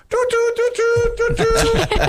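tiny high-pitched car-horn toots; imitated horn taps. Mother politely tapping the horn at drivers, tiny toots. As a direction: squeak out timid little honks. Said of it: "she would tap the horn, not blowing it".

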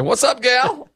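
high, laughing impression of his wife's greeting; mimicking wife. Imitating his wife greeting him: high, laughing. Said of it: "Sometimes she walks out and says".

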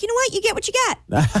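high-pitched, exasperated washing of hands; dismissive verdict. Retold or imitated. Host judging reckless streamers, high dismissive verdict. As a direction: pitch way up, done with their foolishness.

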